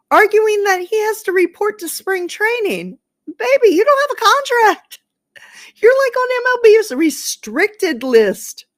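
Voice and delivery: high, loud, incredulous protest; incredulous outrage. Real, not acted. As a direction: squawk it in disbelief at the nerve. Mock outrage at a suspended player's demand.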